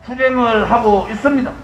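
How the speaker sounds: normal talking voice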